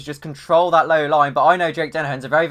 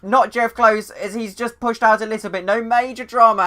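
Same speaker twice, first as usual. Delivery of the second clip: loud, high crash call; excited commentary; 7 semitones higher, 6 dB louder than usual. Real, not acted. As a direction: shout it out as they crash. Crash called live.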